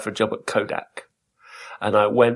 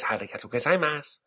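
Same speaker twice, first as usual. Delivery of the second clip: softer, lightly put-on Japanese accent; soft fake Japanese accent; 3 semitones higher, 6 dB quieter than usual. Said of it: "silly fake Japanese voice".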